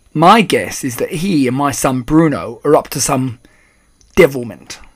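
loud, accusing father; rude and angry. A narrator's reading. Father suspecting the boys of mischief, rude and angry. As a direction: put some angry weight behind it. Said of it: "He spoke rudely and he looked very angry".

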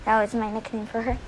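normal talking voice

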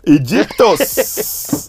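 high, slow, stretched shout of Egyptos; drawn-out exclamation. Real, not acted. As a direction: stretch the word out in delight. Exclaims place name playfully.

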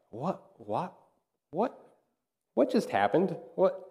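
soft, stunned, breathy "what"; a baffled murmur to himself; dazed disbelief. Retold or imitated. Stunned after losing his temper.

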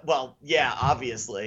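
soft, low, deadpan "obviously"; mock dismissive. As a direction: shrug it off as if it's plain fact. Guy dismisses kid in Prelude.

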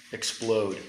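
ordinary talk, nothing marked

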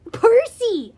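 loud, high startled call; gasped cry. A narrator's reading. Grover amazed at his friend's victory, gasped cry. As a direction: blurt the name in amazement. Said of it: "Grover gasped".

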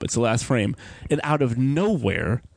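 ordinary delivery

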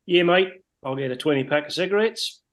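a touch of a Kiwi bloke ordering; broad kiwi accent. Retold or imitated. Retold Kiwi coworker repeats order.

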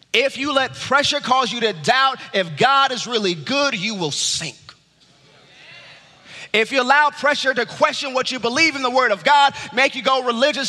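loud, high pulpit climax; preaching at full force. Acted. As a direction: drive it home to the congregation.

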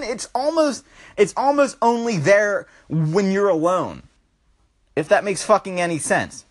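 ordinary talk, nothing marked

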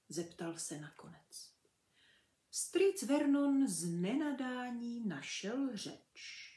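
ordinary delivery

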